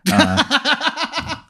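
high-pitched acted giggle; mock laughter. Narrator showing how the player laughs at the man, high laugh. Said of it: "you laugh just like that".

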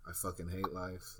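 very soft, understated mock misery; quietly voiced gloom; retold despair. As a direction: say it low in volume, as a resigned aside. Voicing the son's silent despair.

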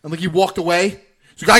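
ordinary talk

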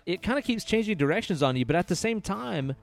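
usual speaking voice

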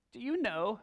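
whiny high-pitched voice; Ahab. Acted. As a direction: start a high, whining question. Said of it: "this is my whiny Ahab voice".

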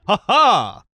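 hammed-up theatrical laugh; a big booming fake laugh; loud stage guffaw. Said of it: "an extremely loud guffaw ... he would go like".

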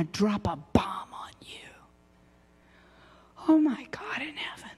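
hushed secretive whisper; breathless awed whisper; dramatic whisper. Acted. Dramatic hush before the revelation.

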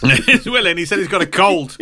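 high, joking echo; playful doorstep caller. Pretend. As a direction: pretend to call at the door, amused. Joins in the acted doorstep joke.

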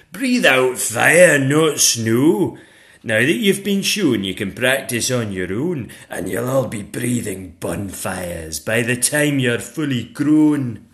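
loud, commanding schoolmistress; demanding dragon teacher. Acted. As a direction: boom instructions at a class of dragons. Madam Dragon teaches fire.

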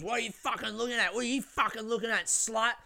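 fast, high mimicry of an angry woman; imitated aggressive challenge. Screaming woman to a passenger who stood up, mock angry challenge. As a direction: fire it off high, mocking her aggression. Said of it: "the woman goes".

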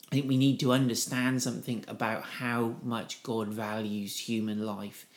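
normal talking voice

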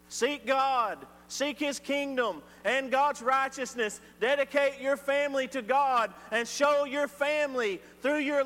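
full-force sermon delivery; fervent preaching. Real, not acted. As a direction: preach it to the pews with conviction.

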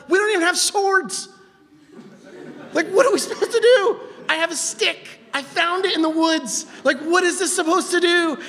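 high, hammed-up complaint; panicked Moses. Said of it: "and Moses is like".

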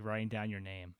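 normal talking voice

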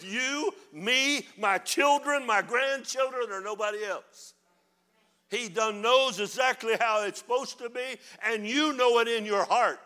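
preacher lists his family, building; building preaching. Acted. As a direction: climb each item like a pulpit crescendo. Preacher builds a list loudly.

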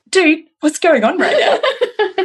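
slow, high, laughing "what's going on"; half-laughing disbelief at her son; exasperated mock question. Mom confronting awkward son.

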